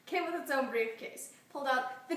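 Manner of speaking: giggling through the words; laughter spilling into the line; laughing. Real, not acted. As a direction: try to say it while still cracking up. Laughing after the retake.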